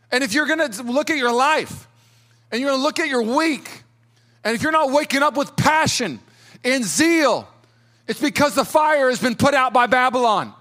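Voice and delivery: high, impassioned sermon delivery; full-force preaching. Real, not acted. Preacher challenging the congregation about lost passion, full-force preaching. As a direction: drive each phrase home with rising zeal.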